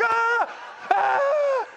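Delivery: high, loud panicked yelp as the dot lands; panicked shout. Retold or imitated. Preacher reliving a red dot on his forehead, yelping in panic. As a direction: shriek to get it off your face. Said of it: "I'm like".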